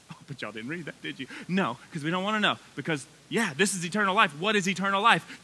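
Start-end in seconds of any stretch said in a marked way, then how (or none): none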